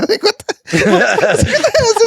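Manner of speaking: cracking up in disbelief; laughing disbelief. Real, not acted. Friend laughing at the saleswoman's warning.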